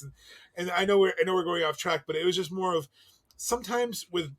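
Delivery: ordinary delivery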